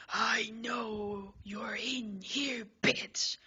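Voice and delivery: slow menace, then a loud bark on bitch; furious hunter calling out; angry yell. Acted. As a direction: growl it slowly, then explode. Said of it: "He yells".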